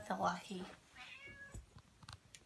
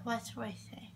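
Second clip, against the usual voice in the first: whisper; 13 dB quieter, much faster than usual. Real, not acted. Groggy patient whispering.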